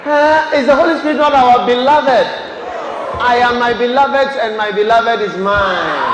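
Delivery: loud preacher's shout, key words stretched; full-force preaching. Real, not acted. Preacher challenges the congregation about their beloved, full-force preaching. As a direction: drive it home to a roaring congregation.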